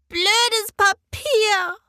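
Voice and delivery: put-on high, slow, loud little girl's cry; stretched-out child's outburst at the paper; angry child shout. A narrator's reading. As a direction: yell it like a furious small girl. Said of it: "ruft sie".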